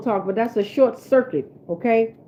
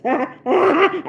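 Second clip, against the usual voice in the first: quick laugh mid-song; 7 semitones higher than usual. Real, not acted. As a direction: breaks into a laugh.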